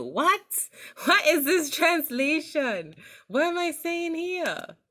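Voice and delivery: baffled, staring at a nonsense caption; shocked disbelief. Real, not acted. Vlogger reacting to a mangled caption, in high shocked disbelief. Reading a garbled auto-caption.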